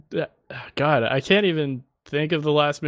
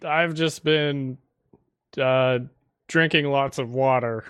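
normal talking voice

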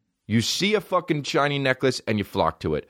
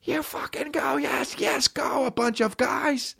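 mock fan cheer; slower than usual. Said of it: "scream for another guy".

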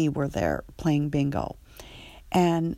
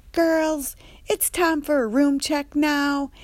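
high, loud sassy staff voice; Andre's accent; 10 semitones higher, 5 dB louder than usual. Retold or imitated. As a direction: play the sassy staff member calling the girls. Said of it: "He kinda had this accent".

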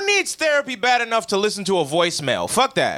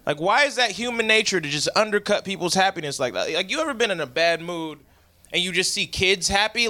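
ordinary talk

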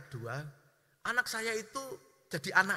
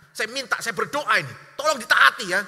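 mock demand; 3 semitones higher, 15 dB louder than usual. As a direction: yell it like an ultimatum. Mock prayer turns into a threat. (tuntutan berteriak)

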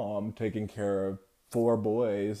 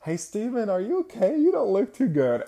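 high-pitched imitation of a holler; hollered concern; 13 semitones higher, 6 dB louder than usual. Classmate hollers across the classroom to Steven, imitated high holler. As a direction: call out a worried check-in, much higher. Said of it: "she hollered at me".

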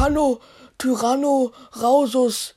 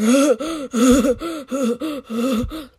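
naughty giggle in short bursts; long giggle. Acted. Child imagines spitting on classmate's head, long giggle. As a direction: giggle on and on at your own joke.

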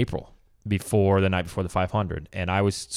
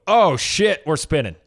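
sudden alarmed radio call, pitched up; spotter radio call; 5 semitones higher, 4 dB louder, slower than usual. Retold or imitated. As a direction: blurt it out over the radio, startled. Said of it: "I come on the radio, and I go".

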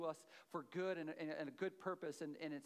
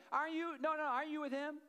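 put-on young girl's voice; 9 semitones higher, 5 dB louder than usual. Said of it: "And then again".

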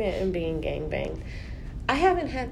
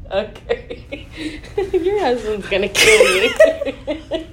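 laughing; 4 semitones higher, 5 dB louder, much slower than usual. Real, not acted. Laughing at the jeans joke.

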